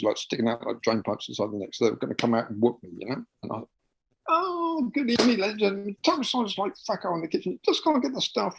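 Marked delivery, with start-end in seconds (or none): 4.3-8.6 very high, fast comic foreign waiter; Manuel-style character. Pretend. Comic waiter greeting the angry diners, very high fast voice. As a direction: burst in as the flustered waiter.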